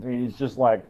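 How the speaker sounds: usual speaking voice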